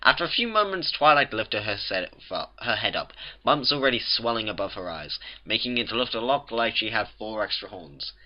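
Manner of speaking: ordinary delivery, nothing marked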